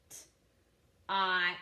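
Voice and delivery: "T-ot" in separate, stretched sounds; sounded out. Shown as a demonstration. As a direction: sound out "T-ot" slowly, piece by piece. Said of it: "I'm gonna sound out those words".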